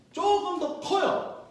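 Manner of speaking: high, slow stretched words; playful teacher emphasis; drawn-out emphasis. Shown as a demonstration.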